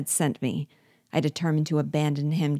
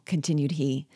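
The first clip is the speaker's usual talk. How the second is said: usual speaking voice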